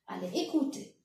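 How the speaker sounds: ordinary talk, nothing marked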